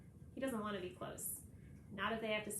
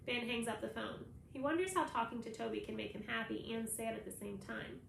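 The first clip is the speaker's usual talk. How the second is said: ordinary delivery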